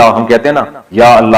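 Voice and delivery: ordinary talk, nothing marked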